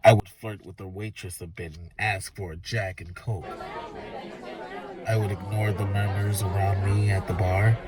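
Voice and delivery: plain reading voice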